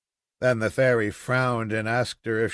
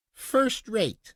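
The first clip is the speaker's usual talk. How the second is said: put-on little girl; 8 semitones higher than usual. Girl answers papa.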